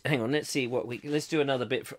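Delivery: ordinary talk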